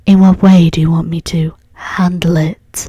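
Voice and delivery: whispery doll voice; breathy little doll whisper; talking doll. Acted. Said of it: "my doll voice".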